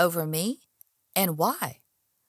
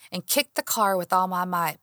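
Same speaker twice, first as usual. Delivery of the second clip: plain reading voice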